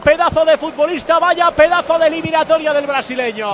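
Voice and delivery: loud, high thrilled praise; excited praise. Real, not acted. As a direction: still buzzing after the goal. Praise right after the goal.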